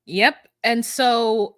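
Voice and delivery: ordinary talk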